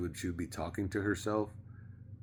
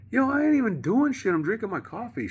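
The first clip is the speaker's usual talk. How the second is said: loud, high-pitched retold protest; indignant protest; 10 dB louder than usual. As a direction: yell back in your own defence. Acted phone quarrel with the landlord.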